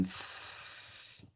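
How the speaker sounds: long unvoiced snake hiss; drawn-out s like a snake. Shown as a demonstration. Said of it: "like a snake going".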